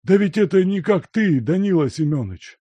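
high, loud astonished exclamation; surprised recognition. A narrator's reading. Servant recognizing the old friend, loud astonished cry. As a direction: gasp out the name in disbelief.

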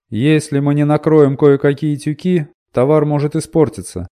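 loud, high man calling out; high tenor voice. A narrator's reading. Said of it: "проговорил высокий тенор".

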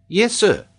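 put-on high child pupil voice; polite schoolboy. A narrator's reading. Boy answers the geographer politely, high schoolboy voice.

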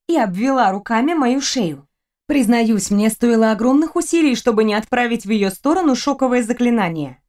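ordinary delivery

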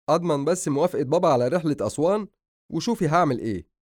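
spirited younger brother's comeback; mock defiance. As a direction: boast back at your sister. Brother answers sister's challenge.

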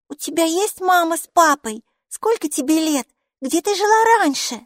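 put-on high, bright child's voice, chattering; a cheerful little girl greeting her strange friend; little girl Maiki. Майки, маленькая девочка, радостно болтает с Букой.

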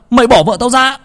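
husband yelling at the thug; furious yell. A narrator's reading. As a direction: scream the demand again harder. Husband shouting at thugs.

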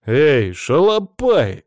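loud, drawn-out call-out; indignant. A narrator's reading. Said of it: "возмутился уже я".

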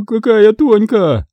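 hopeless moan, high and drawn out; Татьяна Андреевна. A narrator's reading. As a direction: moan slowly in a high, despairing voice. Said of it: "безнадёжно простонала Татьяна Андреевна".

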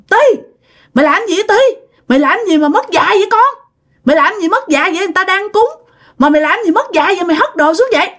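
high-pitched indignant scolding; mắng cháu. Acted. Cô Năm mắng cháu quậy phá. (giọng cao, mắng mỏ bực tức)

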